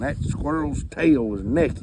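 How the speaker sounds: ordinary talk, nothing marked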